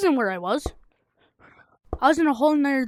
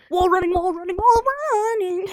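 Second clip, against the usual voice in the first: excited; 7 semitones higher than usual. Real, not acted. Hyped gameplay brag.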